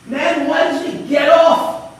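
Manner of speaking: high, loud shout of disbelief; disbelieving shout. Acted. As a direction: yell it, baffled and outraged.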